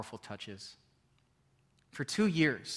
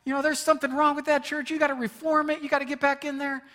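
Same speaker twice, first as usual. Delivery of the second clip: louder, higher, insistent voice; mock the pushy well-wishers; higher and louder imitation of insistent church folk; pushy advice; 10 semitones higher, 6 dB louder than usual.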